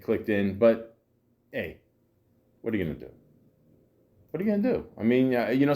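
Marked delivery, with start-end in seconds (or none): 1.5-3.1 low, soft, falling question; resigned shrug. Shown as a demonstration. Rhetorical shrug, said two ways. 4.3-4.9 much higher, rising question; high-pitched shrug. Shown as a demonstration. Same question repeated for effect.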